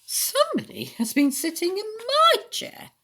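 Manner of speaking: high, loud Mother Bear voice; mellow mother bear. A narrator's reading. Mother Bear finds her chair sat in, high mellow voice. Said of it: "said Mother Bear in a mellow".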